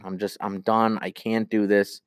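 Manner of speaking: ordinary delivery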